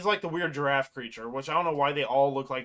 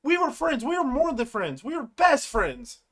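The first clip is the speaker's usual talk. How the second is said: higher-pitched, acted hurt protest; fed up; 9 semitones higher, 4 dB louder than usual. Ribbit insists to Jax they were friends, fed up. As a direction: plead the friendship, imitating someone at their limit. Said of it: "she just had enough".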